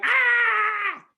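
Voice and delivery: high, loud drawn-out "ah" scream; retold inner scream. Son hearing dad's advice, screaming inside. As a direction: scream out the held-in frustration. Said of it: "My insides be like".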